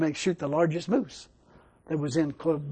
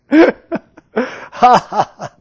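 big, higher burst of laughter; loud laugh; 6 semitones higher, 10 dB louder than usual. Real, not acted. Preacher laughs at his own punchline, loud. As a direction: laugh out at the foolishness.

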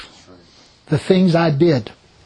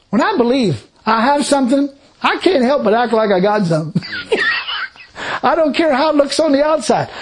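laughing preaching; 6 semitones higher than usual. Real, not acted.

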